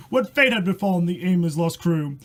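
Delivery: usual speaking voice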